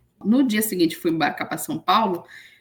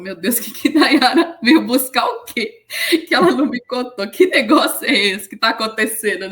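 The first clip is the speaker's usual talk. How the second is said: laughing embarrassed; 4 semitones higher, 6 dB louder than usual. Retold or imitated. Márcio embarrassed, laughing, retold.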